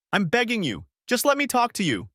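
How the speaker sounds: high, urgent, begging voice; high desperate wife begging her husband; pleading wife. A narrator's reading. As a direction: plead through the car window. Wife pleading, banging on window.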